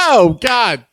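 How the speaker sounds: cracking up while protesting; laughing. Real, not acted. Laughing at crude joke.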